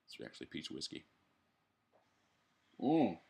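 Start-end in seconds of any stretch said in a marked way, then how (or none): 2.8-3.2 high rise-and-fall hum; loud hum after a strong sip; savoring "mm". Real, not acted. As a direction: relish the swallow with a hum. Reaction after a sip of whiskey.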